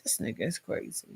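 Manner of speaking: unvoiced whisper to herself; a whispered aside of disbelief; whispered thought. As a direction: breathe it out under your breath. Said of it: "And I was like".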